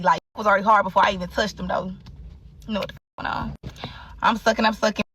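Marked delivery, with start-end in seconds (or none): 2.7-3.5 unvoiced whispered aside. Real, not acted. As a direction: whisper it like a secret.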